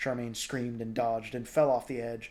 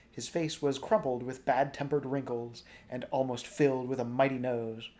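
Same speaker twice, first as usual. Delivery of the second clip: plain reading voice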